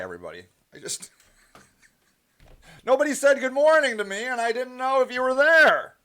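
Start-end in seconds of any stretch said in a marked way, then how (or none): 2.8-5.9 higher-pitched complaint with playful reproach; mock indignant. Streamer chiding viewers for no greeting, mock indignant. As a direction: scold them in a raised, put-out voice.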